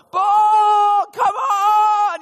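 very high, loud wailing shout; hammed-up anguished yell; desperate cry. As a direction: scream it to the sky. Prophets crying out, cutting themselves.

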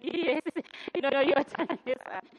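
laughing awkwardly through it; telling her brother his time is up, with an awkward laugh. Real, not acted. Half-laughing to soften the news. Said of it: "It was so awkward".

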